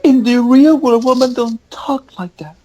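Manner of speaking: very high, slow mocking voice; imitating online complainers. Podcaster mimicking tweets he heard, high mock-indignant voice. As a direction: put on a drawn-out falsetto complaint. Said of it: "tweets of people saying".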